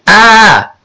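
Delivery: high, drawn-out mock "ah"; child's imitation of his own cry; not-quite scream. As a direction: demonstrate a yelp, holding back volume. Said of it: "It wasn't a scream".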